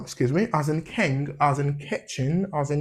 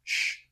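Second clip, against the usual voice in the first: a long hushing "sh"; shushing sound; 6 dB quieter than usual. Shown as a demonstration. Teacher showing the shh sound, long hush. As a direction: shush like quieting someone. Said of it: "sound you make ... shut up".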